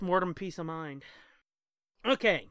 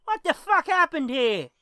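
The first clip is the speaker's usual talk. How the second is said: put-on high, loud British cop accent; 8 semitones higher, 8 dB louder than usual. Joke teller voices a British cop in the punchline. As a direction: bark it like a London copper. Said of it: "the cop walks over and says".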